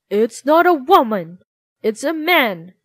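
loud shout of discovery. Acted. Soldiers unmasking the disguised father, shout of discovery. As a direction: call out the unmasking. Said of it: "they shouted".

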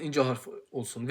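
normal talking voice

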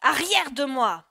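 loud, slow, high-pitched command to back off; angry shout. A narrator's reading. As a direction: shout the child away from you. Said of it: "Mais Matteo lui cria". (cri de colère du père qui repousse)

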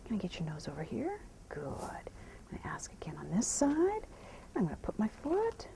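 soft whisper to the horse; whispered cues. Real, not acted. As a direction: whisper so you don't startle him.

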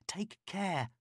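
plain reading voice

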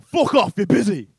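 angry shout. Retold or imitated. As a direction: bark it down the phone at a stranger. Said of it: "And he's like".

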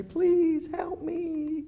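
high, drawn-out helpless plea; pleading. Acted. Meek caller begging customer service, high exaggerated pleading. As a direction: whine it slowly like a helpless caller. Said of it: "You say".

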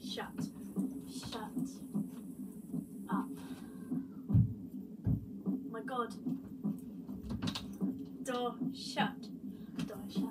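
hushed frightened whisper; scared whisper. Real, not acted. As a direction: whisper alone in the dark.

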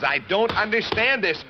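ordinary delivery

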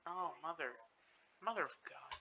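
ordinary delivery

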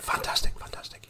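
whispered, under the breath. Retold or imitated. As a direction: mutter it unconvinced. Boss whispers, unimpressed.

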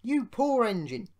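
loud, solemn commiseration; grave. A narrator's reading. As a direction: pity him with great gravity. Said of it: "said the thin controller gravely".

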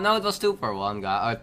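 usual speaking voice

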